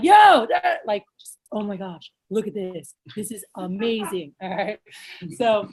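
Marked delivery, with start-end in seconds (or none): 0.0-0.8 high, loud excited "Yo"; imitated hype. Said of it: "So if you're not like". 1.5-4.3 low, hushed mock wonder; quiet amazement. Shown as a demonstration. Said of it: "like, quiet and laid back".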